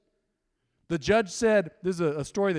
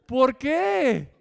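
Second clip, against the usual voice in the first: mock Spanish why; 6 semitones higher, 6 dB louder than usual. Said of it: "I'm like".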